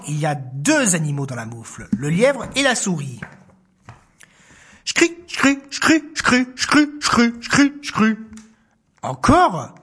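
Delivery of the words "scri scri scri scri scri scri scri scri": higher scratchy patter; footsteps. Acted. Narrator voicing the fox's steps, higher. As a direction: fox steps in the snow. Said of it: "entendent des bruits de pas".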